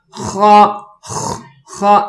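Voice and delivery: short خا, bare rasped خ, then خا; isolated scraping sound. Shown as a demonstration. Teacher has students note the sound, rasped خ isolated. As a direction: break out the throat scrape of خ by itself. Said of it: "پہلے sound note کریں".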